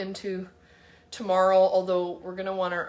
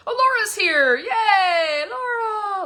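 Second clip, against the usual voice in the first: excited; 11 semitones higher, 9 dB louder, much slower than usual. Real, not acted. Greets coworker joining the stream.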